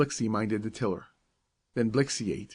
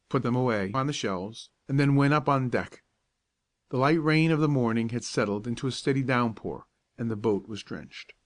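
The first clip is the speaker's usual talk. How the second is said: ordinary delivery, nothing marked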